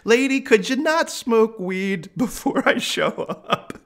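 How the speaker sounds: high, laughing mock complaint; incredulous laughing protest; laughing disbelief. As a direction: laugh through a stunned complaint. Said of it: "I'm like".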